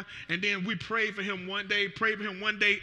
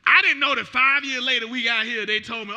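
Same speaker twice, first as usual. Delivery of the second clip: loud, high fervent preaching; 3 semitones higher, 10 dB louder than usual. Real, not acted. Pastor testifying to church, loud fervent preaching. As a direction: build the testimony with fire.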